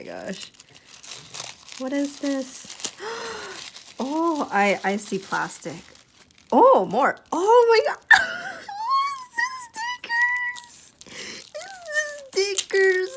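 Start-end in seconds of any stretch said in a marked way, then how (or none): 2.7-3.9 soft, high gasp of surprise; surprised gasp. Real, not acted. Vlogger unwrapping a friend's gift, surprised gasp. 6.5-13.1 very high squeal, close to tearful; overwhelmed delight. Real, not acted. Vlogger finding the stickers her friend made, overwhelmed.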